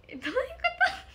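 high, loud baffled question; what on earth do you mean; a startled, loud double take. Real, not acted. Puzzled by an odd compliment.

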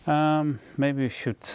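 ordinary talk, nothing marked

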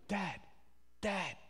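soft little call in the night, lightly acted; a hint of a small child calling quietly; child calling Dad. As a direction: softly imitate a child trying to wake Dad.

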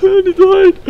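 long high shriek into panicked words; scream, then squeal in real fear; loud, high-pitched panic; terrified scream. Real, not acted. Jump scare, wants to hide.

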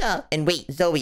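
ordinary talk, nothing marked

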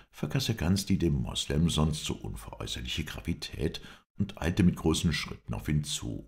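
usual speaking voice

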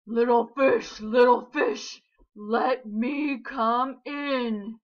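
loud, slow menacing shark; big bad shark. A narrator's reading. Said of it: "roared the shark".

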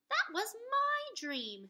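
high, slow amazed reply; surprised. Acted. Jack realising Annie shared his dream, amazed. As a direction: realise she had the same dream.

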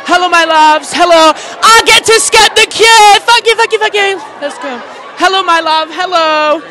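loud, high hellos to the fans; thrilled loud greetings at the event; excited shouting. Real, not acted. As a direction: call out to everyone as you pass. Greeting fans while skipping queue.

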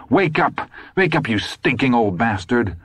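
high, furious wake-up yell; angry shout. A narrator's reading. Todd yelling at the slumped old man, furious shout. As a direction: shake him awake with your voice. Todd shaking Dusander awake.

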